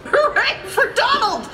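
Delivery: very high, drawn-out cheer. A narrator's reading. Party guests celebrate Donald's bravery, cheering. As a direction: jubilant storybook crowd cry. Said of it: "cried the guests".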